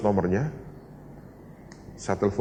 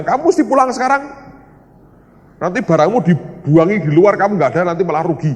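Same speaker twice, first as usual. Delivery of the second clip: high, loud insistent warning; urgent order; 7 semitones higher, 8 dB louder than usual. Retold or imitated. Preacher ordering the man home at once, loud and urgent. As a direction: press them forcefully to leave now.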